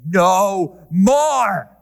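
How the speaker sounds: loud, drawn-out declaration; mock rallying cry. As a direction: proclaim it like a defiant slogan.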